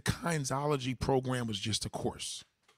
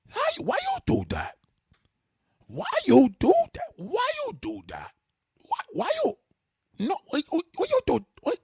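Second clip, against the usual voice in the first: put-on very high, loud Haitian-accented ranting; hammed-up Haitian dad losing it, sputtering the question; crazy Haitian father; 8 dB louder than usual. Imitating Haiti fathers going crazy, frantic Haitian accent. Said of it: "Haiti fathers go crazy".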